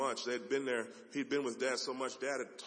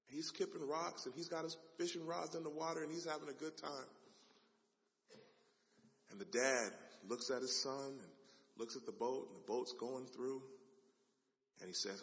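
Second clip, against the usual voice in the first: ordinary delivery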